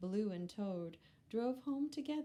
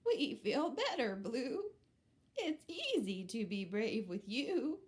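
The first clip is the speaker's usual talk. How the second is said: grateful goat; 4 semitones higher, 5 dB louder than usual. Acted. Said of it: "Goat said".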